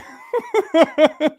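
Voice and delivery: high, loud burst of laughter; laughing at himself while telling the story. Real, not acted. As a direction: laugh out loud at his own line.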